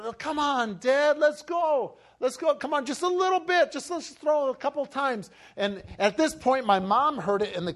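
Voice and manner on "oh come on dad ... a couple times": much higher, insistent coaxing; pleading kid. Retold or imitated. Boy begging dad to play catch, pleading. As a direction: beg like a boy who won't give up.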